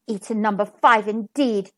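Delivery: usual speaking voice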